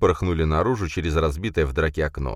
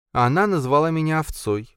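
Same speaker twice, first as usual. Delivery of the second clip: complaining; 9 semitones higher than usual. A narrator's reading. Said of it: "жаловалась Уитни".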